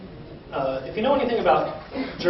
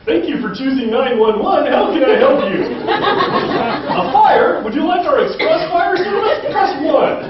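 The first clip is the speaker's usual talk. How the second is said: higher, louder cheery telemarketer pitch; 10 semitones higher, 10 dB louder, slower than usual. Retold or imitated. Dispatcher answering a fire call, perky telemarketer voice. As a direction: sell the caller 911 like a phone menu. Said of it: "sound like a telemarketer".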